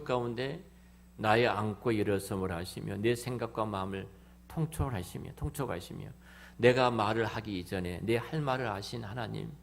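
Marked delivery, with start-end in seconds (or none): none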